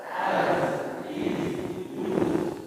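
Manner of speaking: soft "Az, iz, uz" each closed thin; quiet drilled endings. Shown as a demonstration. Teacher quietly modelling az iz uz. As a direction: model the three endings softly. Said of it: "Coba ulang".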